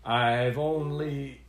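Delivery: slow, stalling, embarrassed start; dwarf reluctantly starting his confession; halting start. Acted. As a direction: drag it out, unwilling to admit. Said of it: "he sighed".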